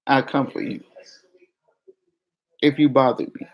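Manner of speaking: ordinary talk, nothing marked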